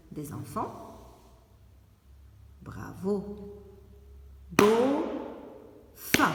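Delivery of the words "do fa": Do, fa called out loud in two beats; loud and a little higher, clapping syllables for children. Shown as a demonstration. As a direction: shout Do and fa apart, one clap each. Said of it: "il y a deux syllabes".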